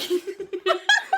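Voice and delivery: high hooting ape cry; ape scream. Shown as a demonstration. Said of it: "You just go like".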